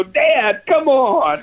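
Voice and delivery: put-on high, laughing teenage-girl whine; daughters' whiny protest. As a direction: play the embarrassed daughter, laughing. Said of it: "they go".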